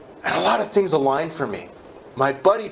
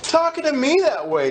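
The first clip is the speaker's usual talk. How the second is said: indignant inner thought; slower than usual. Retold or imitated. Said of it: "I'm like".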